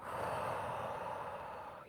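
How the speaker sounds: long, soft, voiceless exhale; long letting-go sigh. Shown as a demonstration. Showing listeners how to let go and sink in, long sigh. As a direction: breathe all the way out to let go.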